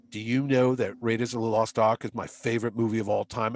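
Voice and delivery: low-pitched actor confiding a secret; imitating Hiddleston. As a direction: drop well down for the impression. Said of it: "He goes".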